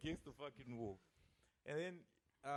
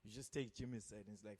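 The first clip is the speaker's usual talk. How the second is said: ordinary talk, nothing marked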